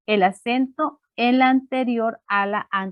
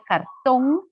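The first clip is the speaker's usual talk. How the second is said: cartón with a drawn-out, rising TÓN; stress on last syllable; 2 semitones higher than usual. Shown as a demonstration. Teacher showing students where the accent falls, last syllable stressed. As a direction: lean on the final syllable of cartón. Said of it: "el acento en la última sílaba".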